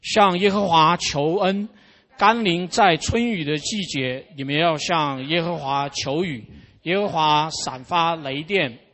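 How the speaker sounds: loud, slow congregational reading; slow, emphatic scripture recitation; reading scripture together. Real, not acted. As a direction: lead the room in reading a verse aloud. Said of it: "我们一起来念".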